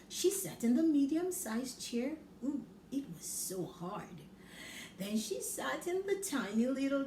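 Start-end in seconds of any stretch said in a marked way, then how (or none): none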